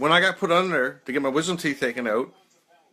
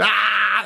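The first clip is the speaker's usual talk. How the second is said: loud, high imitated scream of fright; startled scream; 10 semitones higher, 8 dB louder than usual. Dad jolted by his son in the dark hallway, retelling his loud scream. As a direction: belt out a sudden startled "Ah!". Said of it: "So I screamed".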